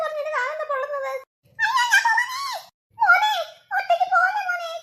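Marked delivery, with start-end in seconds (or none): 1.6-4.7 higher, loud alarmed shout to her son; a mother crying out after her son. Acted. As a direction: call after the boy in panic. Mother cries after leaving son.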